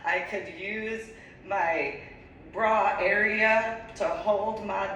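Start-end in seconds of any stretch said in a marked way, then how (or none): none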